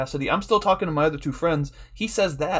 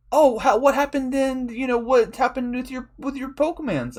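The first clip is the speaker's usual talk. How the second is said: high-pitched imitation of prying friends; put-on high voice, clueless questioning; mock nosy friend; 9 semitones higher than usual. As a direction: mimic a friend making chirpy small talk. Mimicking friends asking about his content.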